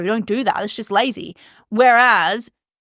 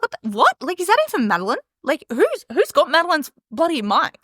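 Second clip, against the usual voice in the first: higher-pitched, incredulous questioning; bewildered listener; 7 semitones higher than usual. Acted. Listeners wondering who has the podcaster's mic, bewildered disbelief. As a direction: act thrown, demanding who this impostor is. Listeners baffled by unfamiliar host.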